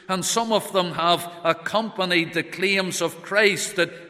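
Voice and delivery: loud, high, fervent pulpit voice; full-force preaching. Acted. Preacher recounting the Lord's miracles, loud. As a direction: proclaim the miracles with rising fervour.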